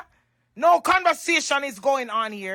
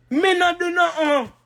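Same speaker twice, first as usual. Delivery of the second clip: raised, forceful repeat of the denial; emphatic denial; 4 semitones higher, 4 dB louder than usual. Real, not acted. Woman denying she wronged the girl, emphatic. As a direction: punch it out higher, protesting.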